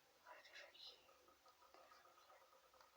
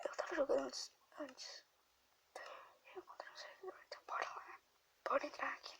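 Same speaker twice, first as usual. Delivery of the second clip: whispering; 6 dB quieter than usual. Real, not acted. Whispers while grandma sleeps.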